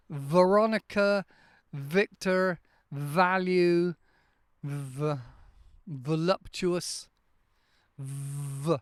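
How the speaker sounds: "Veronica, Victor, value" with a buzzing V, lip against the teeth. Shown as a demonstration. Teacher drilling students on a vibrating V sound. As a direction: make the V vibrate on the bottom lip. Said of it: "get that vibration".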